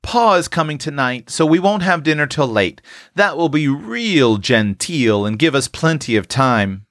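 put-on young housekeeper. A narrator's reading. Tilly planning a late dinner for Pa, self-important. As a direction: add the plan brightly, as a child taking charge. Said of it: "added Tilly".

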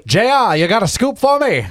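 high, loud newsroom editor; old-timey editor. Pretend. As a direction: call out to your reporter like a 1940s editor. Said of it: "old-timey editor voice again".

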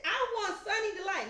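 high, loud imitated tantrum; mock a child stamping out a demand; foot-stomping demand. Stepdaughter stopping her in the pasta aisle, loud tantrum. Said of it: "stomped her feet and crossed her arms".

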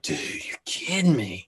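slow, exasperated whisper to himself; whispered frustration. Retold or imitated. Lying in bed, cursing his bladder in a slow whisper. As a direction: breathe it out like a private groan in bed.

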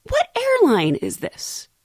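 high, drawn-out puzzled question; high-voiced clueless passenger. Retold clueless passenger asking.

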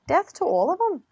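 sing-song the line like a Scot; sing-songy Scottish. Shown as a demonstration. Showing co-host the difference, sing-songy Scottish accent. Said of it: "It would be more sing-songy in Scottish".

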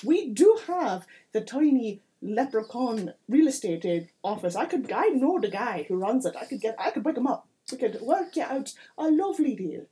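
animated mayor in a put-on accent; salesman-like mayor with a bad accent; chatty village mayor. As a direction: play a folksy mayor with a hammy accent, lively and rambling. Game master plays the mayor.

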